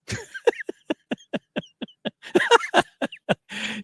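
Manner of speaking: long high-pitched cackle; long high laugh. Real, not acted. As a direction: laugh it off, caught out. Laughs at own character flaw.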